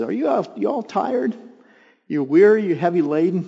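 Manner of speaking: inviting Jesus. Acted.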